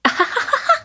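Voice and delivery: high delighted giggle; a bright happy giggle; high laugh. Acted. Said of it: "She laughs".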